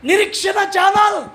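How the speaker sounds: high slow shouted climax; shouted loud and slow as the list of channels peaks; building shout. Acted.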